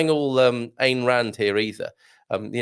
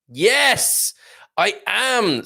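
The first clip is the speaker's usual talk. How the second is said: emphatic yes; 9 semitones higher, 4 dB louder, much slower than usual. Real, not acted. Emphatic answer after pause.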